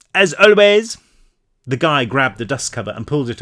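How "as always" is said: put-on French accent. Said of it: "I'm French now".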